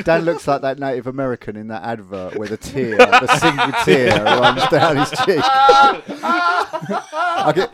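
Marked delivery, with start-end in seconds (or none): 1.8-7.7 words through laughter, slower at the tear; real giggling tease; laughing while talking. Real, not acted. Laughing while mocking friend.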